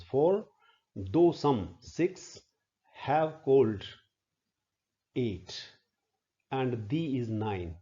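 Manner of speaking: "death", "not proud", "though some" spaced out and counted one by one; counting syllables. Shown as a demonstration. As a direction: pause after each counted syllable group. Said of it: "one syllable".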